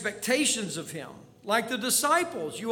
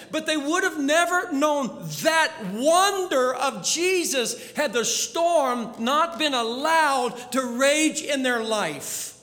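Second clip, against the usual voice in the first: usual speaking voice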